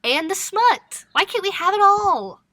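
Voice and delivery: high-pitched, playful wail of protest; mock pleading. Co-host pleading for fantasy, romance and smut, mock pleading. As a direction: plead high and dramatically, like being denied.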